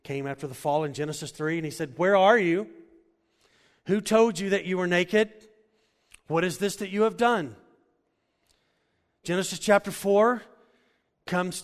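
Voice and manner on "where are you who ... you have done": loud, high preaching call of God. Acted. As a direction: boom it out as God searching for Adam. God calls Adam after the fall.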